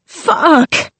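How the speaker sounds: sudden high pained cry; scream of pain. A narrator's reading. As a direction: cry out as the head hits the wall. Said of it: "she screamed".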